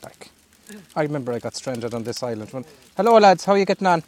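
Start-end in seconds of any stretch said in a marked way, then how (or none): 3.0-4.0 calling out. Real, not acted. Calling out to passing boat.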